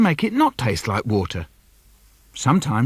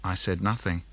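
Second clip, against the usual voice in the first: soft, low sulky murmur; sulky quiet; 9 semitones lower, 10 dB quieter than usual. Acted. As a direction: say it under your breath. Sulky silence line.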